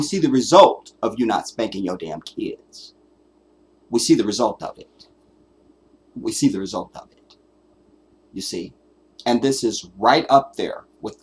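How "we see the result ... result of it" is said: ordinary talk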